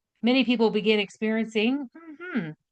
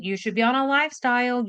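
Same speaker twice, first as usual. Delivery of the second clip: ordinary talk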